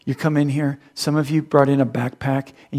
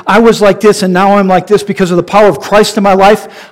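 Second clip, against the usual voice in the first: loud, high preaching at full force; fiery preaching; 7 semitones higher, 8 dB louder than usual. Acted. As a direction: preach it hard to the room.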